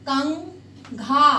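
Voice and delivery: कंघा stretched slowly, the nasal ङ held before घा; slow nasal demo. Shown as a demonstration. Teacher showing pupils the nasal in कंघा, slowly. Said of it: "टंग है वो ऊपर तालू को लगेगी".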